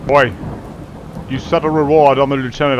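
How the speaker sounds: normal talking voice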